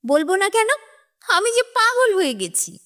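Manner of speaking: high wailing sob in the voice; crying out. A narrator's reading. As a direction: burst into tears as she speaks. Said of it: "ডুকরে কেঁদে উঠে বললেন". (ডুকরে কেঁদে বলা)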